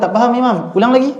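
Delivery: pleading student. Retold or imitated. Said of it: "dia kata".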